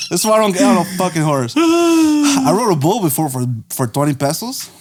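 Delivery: normal talking voice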